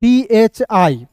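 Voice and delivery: letters spelled out very slowly; slow letter spelling. Shown as a demonstration. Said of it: "मैंने यहाँ पे लिखा हुआ". (धीरे-धीरे स्पेलिंग)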